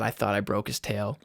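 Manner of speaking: normal talking voice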